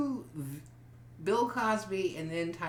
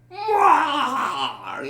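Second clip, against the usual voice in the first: Bill Cosby impression; 7 semitones higher, 11 dB louder than usual. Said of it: "a Bill Cosby".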